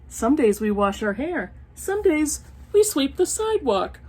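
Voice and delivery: sing-song picture book reading; reading to children. Acted. Reading an old picture book aloud to her viewers, storytime voice. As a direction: read it like a storytime page.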